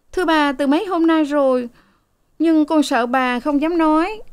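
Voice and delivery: high, hesitant servant voice; timid maid. A narrator's reading. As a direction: confess meekly, pitched high. (giọng cô hầu rụt rè, cao)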